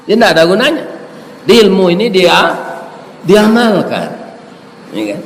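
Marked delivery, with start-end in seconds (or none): none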